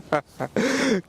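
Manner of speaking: high joyful laughter. Real, not acted. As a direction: laugh with delight. Birdie just holed.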